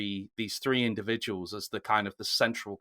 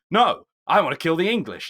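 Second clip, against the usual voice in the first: raised, louder mock defiance; defiant retort; 7 semitones higher, 8 dB louder than usual. Retelling the hero overruling the nobles, mock defiant. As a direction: act out a hot-headed refusal, pitched up and loud. Acts out Wallace arguing with nobles.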